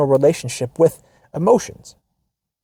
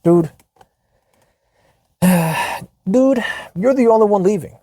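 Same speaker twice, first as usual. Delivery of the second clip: exasperated; 6 semitones higher than usual. Real, not acted. Exasperated reaction to letter.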